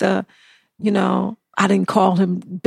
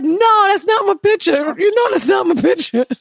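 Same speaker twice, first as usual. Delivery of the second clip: put-on indignant drunk insistence; 8 semitones higher than usual. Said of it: "And I was like".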